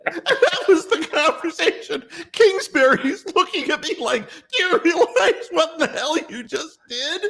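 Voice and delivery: very high, laughing through it. Real, not acted. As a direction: squeak it out while cracking up. Telling it while laughing hard.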